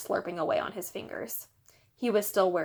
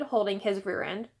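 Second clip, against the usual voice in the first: ordinary delivery, nothing marked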